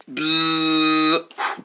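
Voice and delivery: low, soft imitated buzzing; fly buzz. Fly pestering the reader, low buzz.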